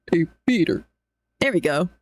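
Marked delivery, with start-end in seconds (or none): 0.1-0.9 lower, steady cartoon dad voice; low Hey Peter voice. Shown as a demonstration. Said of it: "versus Hey Peter".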